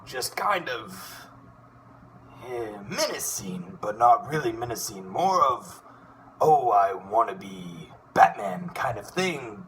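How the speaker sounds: a hint of a would-be menacing hero, slow; half-menacing wannabe Batman tone, drawn out; testing the Darkwing voice. Shown as a demonstration. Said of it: "I probably can do his voice".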